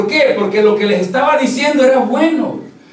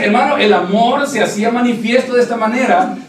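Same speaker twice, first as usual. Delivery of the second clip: usual speaking voice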